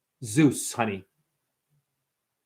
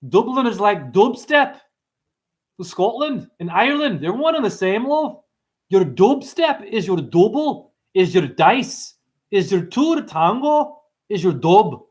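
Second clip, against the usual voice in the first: lilting, higher-pitched mock Scottish-Irish accent; 4 semitones higher than usual. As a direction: put on a playful Irish lilt, stretching the words. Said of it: "I go to Scotland accent".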